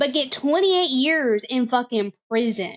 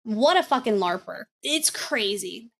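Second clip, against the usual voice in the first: normal talking voice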